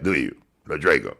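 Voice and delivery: usual speaking voice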